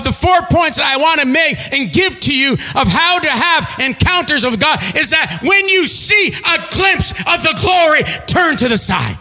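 high, ringing preacher's voice; fervent sermon climax; preaching at full force. Acted. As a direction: proclaim it to the room.

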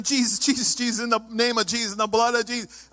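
usual speaking voice

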